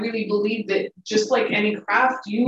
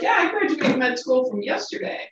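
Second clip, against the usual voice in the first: cocksure new graduate; 4 semitones higher, 4 dB quieter than usual. Retold or imitated. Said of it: "if they're like".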